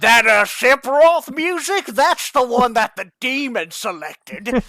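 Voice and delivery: hammed-up dramatic suspect, slow; mock suspect. Imagined suspect quote.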